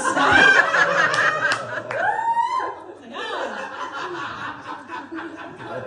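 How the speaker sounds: can't stop laughing; helpless laugh. Real, not acted. Laughing at her own story.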